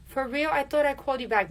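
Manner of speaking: high-pitched mocking imitation; airy fake innocence. Mocking scheduler in retold call.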